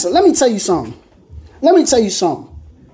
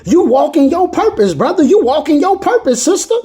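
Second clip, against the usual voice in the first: usual speaking voice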